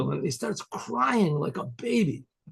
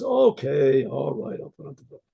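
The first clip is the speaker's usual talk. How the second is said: reluctant giving in; 2 semitones lower than usual. Retold or imitated. Husband finally gives in to his wife, reluctant. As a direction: give in grudgingly, trailing away. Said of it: "he gives in to his wife".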